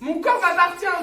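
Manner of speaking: loud, furious assertion; angry. Real, not acted. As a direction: shout it with outrage. Heated argument.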